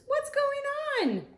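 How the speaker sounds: high, slow puzzled voice; confused monkey voice. Acted. Teacher voicing the confused monkey Jim, high and slow. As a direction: voice Jim's bewilderment, stretched out.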